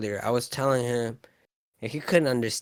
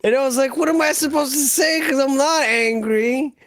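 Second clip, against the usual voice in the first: loud, high, worked-up protest; exasperated near-shout; screaming; 10 semitones higher, 6 dB louder than usual. Real, not acted. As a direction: raise your voice high and plead your case. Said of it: "Yeah, but you were screaming".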